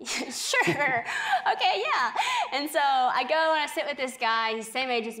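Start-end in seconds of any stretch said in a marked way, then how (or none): 0.0-2.1 high, squeaky hesitant yes; half-laughing, agree against your better judgement; reluctant agreement. Retold or imitated. Acts out her hesitant agreement.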